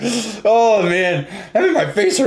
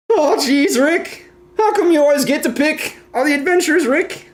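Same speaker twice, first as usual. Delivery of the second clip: high-pitched nervous plea to Rick; whiny cartoon character; 11 semitones higher than usual. High impression voice addressing Rick.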